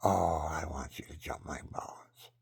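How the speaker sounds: woman whispering a proposition to him; whispered come-on. Retold or imitated. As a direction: whisper it like a seductive invitation. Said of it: "she says".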